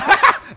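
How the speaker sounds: high, loud burst of laughter; sudden mocking laugh. Real, not acted. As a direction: burst out laughing at your rival.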